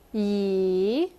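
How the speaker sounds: "Yi" dipping low, then rising; third tone. Shown as a demonstration. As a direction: draw out "Yi", down and back up. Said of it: "down and up".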